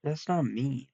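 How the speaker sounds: ordinary talk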